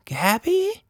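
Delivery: put-on high, wondering old woman's question; gently recognise an old friend; softened. A narrator's reading. Anita recognising Gabby, high and wondering. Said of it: "her voice softened".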